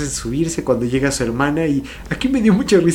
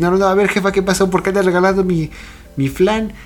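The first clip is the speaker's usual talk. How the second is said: higher, lightly voiced outraged teen; a hint of a put-out daughter, pitched up; indignant Makoto; 7 semitones higher, 4 dB louder than usual. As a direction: protest to mom like a girl whose dessert is being given away. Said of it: "Esta Makoto se oye eso y dice".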